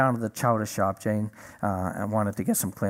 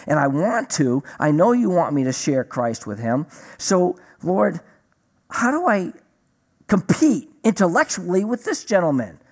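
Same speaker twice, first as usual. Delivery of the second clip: retold plea for help, pressing and emphatic; mildly desperate appeal to the Lord; acting out an urgent prayer; emergency prayer; 5 semitones higher, 4 dB louder than usual. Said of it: "I pray my nine one one prayers".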